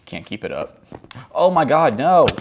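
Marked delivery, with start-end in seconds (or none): 1.3-2.3 loud, higher-pitched cry of dismay; real yelp of protest; dismayed outburst. Real, not acted. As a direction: cry out as it all goes wrong. Game mishap, cries out.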